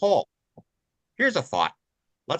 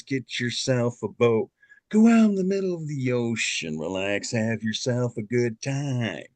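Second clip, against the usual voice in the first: weary captain in Nicholson's voice; Jack Nicholson impression; 6 semitones lower than usual. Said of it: "Jack Nicholson as the captain".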